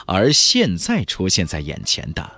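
ordinary delivery, nothing marked

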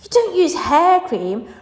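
slow, high, loud protest; incredulous cry. A narrator's reading. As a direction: object in drawn-out disbelief. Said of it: "cried Mike Teavee".

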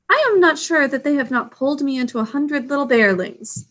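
ordinary delivery

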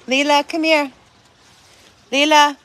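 high, loud, sweet pet call; calling the dog. Real, not acted. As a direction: call a little dog over to you.

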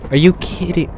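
sudden high Are you kidding; startled disbelief. Real, not acted. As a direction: jump in shock. Startled by lightning.

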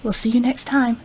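plain reading voice

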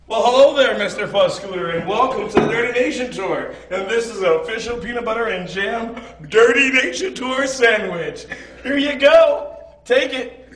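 loud, high, beaming welcome; over-the-top host greeting. Acted. Handing a tour sandwich to Mister Fuzz, loud and high. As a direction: greet him like a game show host.

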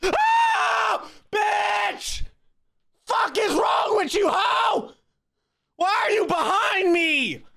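high loud furious shriek after the scare; panicked shouting at the figure behind her; terrified screaming. Real, not acted. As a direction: scream and curse at the intruder. Jump scared by someone behind.

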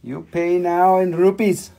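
loud, high hammed-up hawker voice; jokey market-stall seller pitch; pushy street seller. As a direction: play a pushy bazaar salesman haggling. Playful pushy crystal seller bit.

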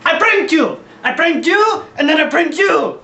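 high manic gloating shout; gleeful shouting. Pretend. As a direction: crow over the prank. Gloating over prank.

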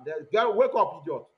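loud, impatient mock command; bossy retold order. Ordering a girl to wake up, loud and scolding. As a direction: rouse someone with a loud scolding.

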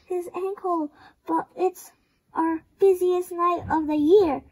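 usual speaking voice

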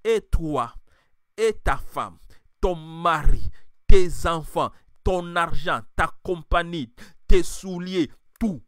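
full-force sermon delivery; fiery preaching. Acted. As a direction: rouse the congregation.

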